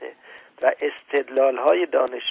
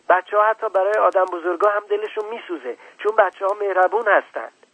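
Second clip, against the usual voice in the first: put-on higher, louder angry child; 6 semitones higher, 5 dB louder than usual. Little prince scolding the grown-ups, higher angry child voice. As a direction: build a tearful child's outrage. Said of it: "با خشم بیشتری ادامه داد".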